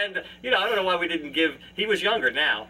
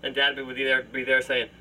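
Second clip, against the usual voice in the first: normal talking voice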